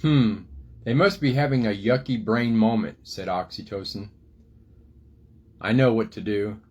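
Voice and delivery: lively picture-book storytelling voice; reading to children. A narrator's reading. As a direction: tell the story to little kids.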